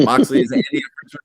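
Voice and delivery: high, loud, fast call to chat; excited call-out. Acted. Calling out to a viewer with loud excitement. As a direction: fire the question at Moxley like a game-show host.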